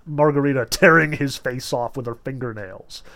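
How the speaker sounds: ordinary delivery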